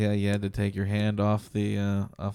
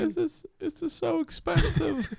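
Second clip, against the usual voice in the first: whiny mom. Pretend. Whiny high voice.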